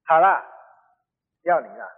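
loud sigh-like concession, then sinking; reluctant giving in. Retold or imitated. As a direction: give in against your will. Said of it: "她只好说".

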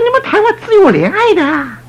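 mock surprise. Pastor surprised at wife.

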